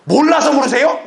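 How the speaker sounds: loud, high comeback; mock indignant retort. Believer retorts to the Lord's question, mock indignant. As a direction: throw it back loudly, up high.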